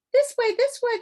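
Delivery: very high, loud guiding call. Acted. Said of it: "the voice called again".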